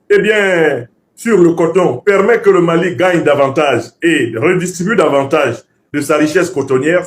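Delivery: ordinary delivery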